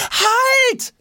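high loud yell; shout. A narrator's reading. Boy yelling after his suitcase, desperate. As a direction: yell at the departing cart. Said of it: "schreit Jonas".

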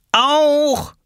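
put-on high, insistent little-kid cry; child calling out. Little brother demands the same role, high child's call. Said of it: "rief Lars".

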